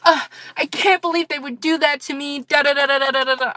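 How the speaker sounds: mock a self-pitying complainer; mocking whiner. Streamer mocking people who whine about being bullied, high whiny mock voice. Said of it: "complain about it like".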